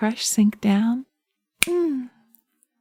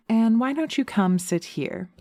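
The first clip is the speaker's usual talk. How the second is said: ordinary talk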